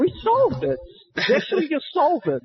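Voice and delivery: high triumphant announcement; proud mock triumph; excited. Triumphant joke claim.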